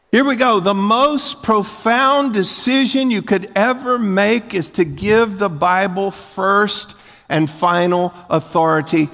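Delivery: high, measured, slow proclamation; emphatic preaching. Real, not acted. Preaches key point at full force.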